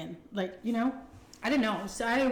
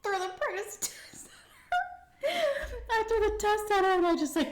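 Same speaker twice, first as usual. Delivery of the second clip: milked sobbing; 14 semitones higher than usual. Retold or imitated. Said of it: "I was totally milking it".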